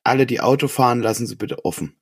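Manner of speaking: ordinary talk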